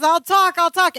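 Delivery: hammed-up cry to be let out; panicked Larry. Larry grabbing the cell bars, mock panic.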